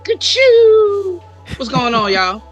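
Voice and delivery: ordinary talk, nothing marked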